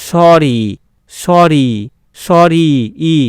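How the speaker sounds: "সরি" with the final i held long; long i ending. Shown as a demonstration. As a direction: model "সরি" stretching the ee at the end. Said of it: "দীর্ঘস্বর উচ্চারণ হচ্ছে".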